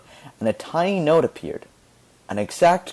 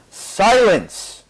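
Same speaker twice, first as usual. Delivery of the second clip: loud, higher, commanding bark; booming command; 8 semitones higher, 4 dB louder than usual. A narrator's reading. Vivaldi stopping the mocking musicians, booming. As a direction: silence the room at once. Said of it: "a voice boomed".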